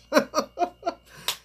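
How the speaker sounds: cackling laughter, high and loud. Real, not acted. Laughing at the clip.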